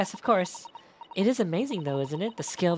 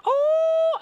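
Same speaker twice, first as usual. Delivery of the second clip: much higher, louder mock "Oh!" yell; mock scream; 9 dB louder than usual. Streamer retells the dog's scream to chat, high mock yelp. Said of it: "And then he just screams".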